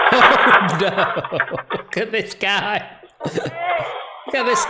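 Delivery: high, slow, cracking up; laughing. Real, not acted. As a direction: barely get it out through real laughter. Cracking up at the clip.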